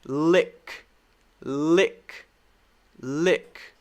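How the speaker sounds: "lick" said alone, short "ih", rising at the end; short I demo. Shown as a demonstration. Teacher drilling short I for learners, careful rising "lick". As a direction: model each "lick" carefully with a lift. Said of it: "this is the short I sound".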